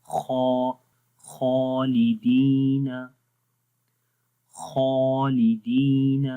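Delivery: خالدین drawn out with a heavy, full-mouthed خا; slow Quranic recitation of خالدین; heavy kha recitation. Shown as a demonstration. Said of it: "تو پڑھیں گے".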